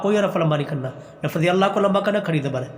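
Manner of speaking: ordinary delivery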